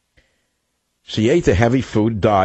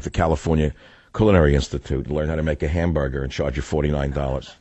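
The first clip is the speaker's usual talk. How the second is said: ordinary talk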